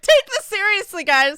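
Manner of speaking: mock commenter. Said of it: "People on YouTube be like".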